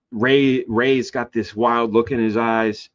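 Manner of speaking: usual speaking voice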